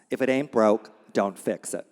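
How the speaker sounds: normal talking voice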